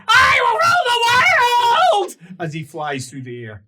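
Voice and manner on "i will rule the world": booming villain declaring world rule; megalomaniac rant. Acted. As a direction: roar it like a mad emperor. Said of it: "And he goes".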